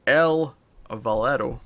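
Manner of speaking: drawn-out, word by word; slow, careful pronunciation. Shown as a demonstration. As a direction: say it slowly and deliberately. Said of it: "I'm gonna say this slowly".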